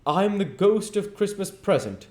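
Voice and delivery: high, loud grand announcement; hearty ghost announcing. Acted. Ghost introduces himself to Scrooge, high and loud. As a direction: proclaim yourself like a jolly giant. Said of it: "said the spirit".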